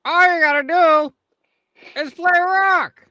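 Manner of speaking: high, loud punchline delivery; joker's hammed-up routine. [name] pitching a joke to annoyed listeners, hammed-up. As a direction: belt out the gag high, stretching the last words.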